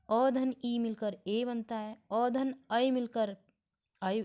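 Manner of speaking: slow step-by-step vowel sums; walk each combination through slowly; slow working through. Shown as a demonstration. Teacher showing students how vowels join, slowly. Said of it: "देखिए".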